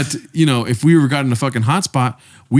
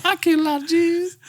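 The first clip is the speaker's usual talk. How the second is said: much higher, dainty Southern belle voice; Southern belle Hitler. Acted. Making a friend laugh with Hitler as a Southern belle, high dainty voice. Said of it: "Southern Belle Hitler".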